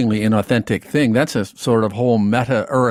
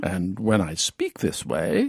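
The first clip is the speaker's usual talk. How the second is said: slow, softer British accent; 6 semitones lower, 6 dB quieter, slower than usual. Shown as a demonstration. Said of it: "So let's try this".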